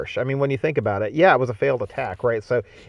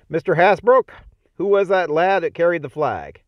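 colonel calling out; 5 semitones higher, 3 dB louder than usual. A narrator's reading. Colonel calls out to lieutenant.

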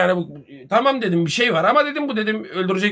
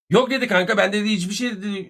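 normal talking voice

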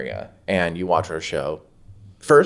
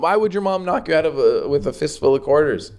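pitched-up disbelieving questions; can't believe what he's hearing; incredulous; 9 semitones higher, 3 dB louder than usual. Real, not acted. Cohost asking in disbelief why his mom would.

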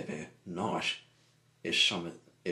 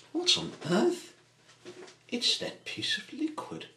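high, loud cry of disbelief; startled alarm; 14 semitones higher, 6 dB louder than usual. Acted. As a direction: jump in pitch with shock. Startled by thing coming alive.

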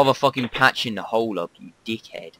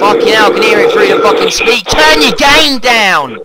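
angry shout; 10 semitones higher, 11 dB louder than usual. Real, not acted. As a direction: bellow to be heard over noise. Annoyed by loud game noise.

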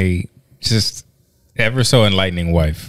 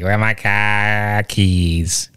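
slow, drawn-out mock Boston accent; husband mimicking his wife's drunk Boston accent; much slower than usual. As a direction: stretch it out like a tipsy Bostonian. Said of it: "she be all bougie when she on the mic".

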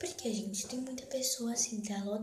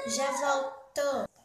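slow, louder call; drawn-out call; 5 semitones higher, 7 dB louder, much slower than usual. Real, not acted. Girl calling to viewers as she steps away. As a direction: stretch it out as you leave.

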